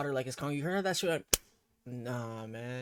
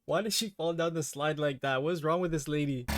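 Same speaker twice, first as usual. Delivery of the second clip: ordinary talk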